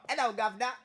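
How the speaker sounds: cheery mock English accent greeting; a playful put-on English accent. Streamer greets chat in a mock English accent. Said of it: "what do you think of my English accent".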